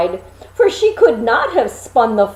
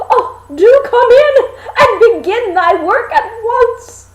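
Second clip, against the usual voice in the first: put-on eager girl; 12 semitones higher, 6 dB louder than usual. A narrator's reading. Girl greeting the three helpers, high and overjoyed. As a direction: cry it out in joyful relief.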